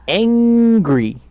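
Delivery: "angry" loud, high and drawn out; stress on angry. Shown as a demonstration. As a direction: punch "angry" as the key word, pitch leaping up. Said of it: "This is the key word".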